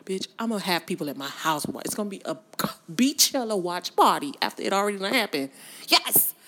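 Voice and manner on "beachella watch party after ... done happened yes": loud excited yell; excited cheer. Real, not acted. Vlogger cheering about a Beachella watch party, loud.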